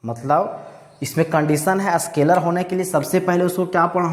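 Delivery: ordinary talk, nothing marked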